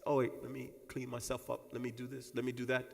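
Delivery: soft, low murmur to yourself; quiet inner voice. Pretend. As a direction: mutter it like fixing yourself in a mirror. Imagined self-talk at mirror.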